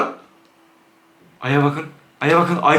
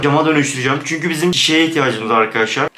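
ordinary talk

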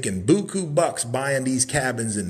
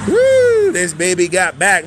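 high, much louder whoop into the line; excited hype; 4 semitones higher, 9 dB louder than usual. Real, not acted. Host hyping the big cabin to viewers, loud excited whoop. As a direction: shout it out with hype.

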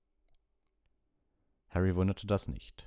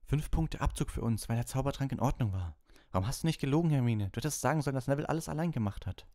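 put-on high, aggrieved boyish complaint; indignant Ron; 9 semitones higher, faster than usual. Ron complaining to Hermine on the stairs, indignant. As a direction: vent at her for not lying.

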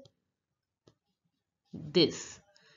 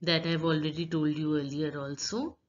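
ordinary delivery

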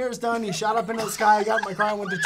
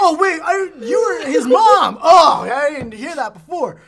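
louder, higher, slow astonished take; acted surprise; 6 semitones higher, 8 dB louder, much slower than usual. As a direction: overplay sudden surprise, stretching the words. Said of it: "do it again with more surprise".

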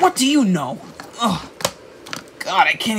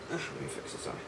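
softer, whispered mutter; whispered aside; 7 semitones lower, 19 dB quieter, faster than usual. Real, not acted. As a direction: whisper it off-mic. Unvoiced aside while adjusting.

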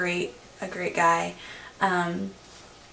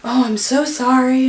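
embarrassed mom; 5 semitones higher, 7 dB louder than usual. Retold or imitated. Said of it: "she's so embarrassed".

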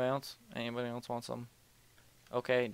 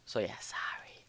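whisper; 3 semitones lower, 5 dB quieter than usual. Real, not acted. Apologizing under breath.

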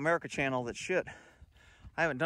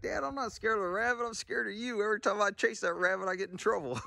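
dog's voice, pitched higher; voicing the dog; 7 semitones higher than usual. Owner voices the dog answering why he's scared, higher pet voice. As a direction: speak for the dog as a sheepish pup. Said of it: "He's like".

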